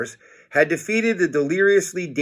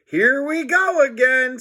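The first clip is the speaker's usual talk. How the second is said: high panicked scream; 11 semitones higher than usual. A narrator's reading. George dreads the chase, high panicked scream. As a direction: wail it in alarm. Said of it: "screamed George".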